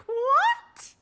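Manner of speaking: very high, loud mock disbelief; high-pitched, exaggerated reaction; incredulous. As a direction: squeal it in baffled disbelief. Baffled reaction to Uno showing up.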